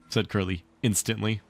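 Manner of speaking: ordinary delivery, nothing marked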